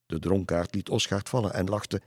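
usual speaking voice

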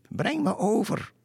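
very high, sustained call; calling woman's voice. A narrator's reading. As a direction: call out across the water as a woman. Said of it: "De vrouwenstem riep". (hoog roepende vrouwenstem)

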